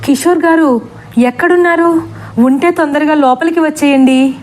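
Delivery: calling loudly. Acted. Master calls Kishore from the window, mild mock yell. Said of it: "మళ్ళీ గట్టిగా".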